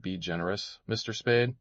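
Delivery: usual speaking voice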